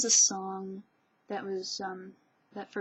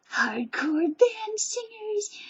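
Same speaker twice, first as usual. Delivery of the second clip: much higher, louder, stretched out; gushing squeal; 13 semitones higher, 6 dB louder, much slower than usual. Real, not acted. Vlogger gushing about a Japanese band, high drawn-out squeal. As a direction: squeal it slowly in cute delight.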